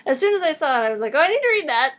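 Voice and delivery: high, loud and eager; excited. Retold or imitated. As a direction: gush like you just found treasure. Excited retelling of seeing book.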